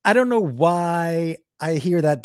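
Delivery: normal talking voice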